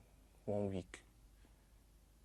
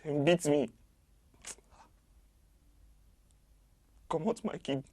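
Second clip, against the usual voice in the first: anguished outcry; 5 semitones higher, 7 dB louder than usual. Acted. Anguished account of torture.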